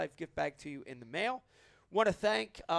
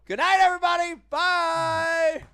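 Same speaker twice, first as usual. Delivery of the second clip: big sign-off; 11 semitones higher, 12 dB louder than usual. Real, not acted. Loud sign-off.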